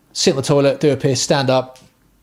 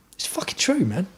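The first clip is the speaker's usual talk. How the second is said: high-pitched insistence; 7 semitones higher, 6 dB quieter than usual. Real, not acted. Insisting on toilet point.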